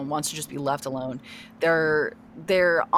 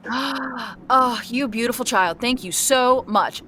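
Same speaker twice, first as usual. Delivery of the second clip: a touch higher, gushing thanks; delighted mayor; 7 semitones higher, slower than usual. Acted. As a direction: thank the kid like a grateful mayor.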